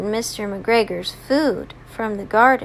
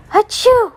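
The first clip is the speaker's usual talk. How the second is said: high gasp, then loud mock achoo; pretend sneeze; 9 semitones higher, 7 dB louder than usual. Peter Rabbit hiding in the water can, high pretend sneeze. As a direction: suck in air and burst into a play sneeze. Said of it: "Peter Rabbit sneezed. He went".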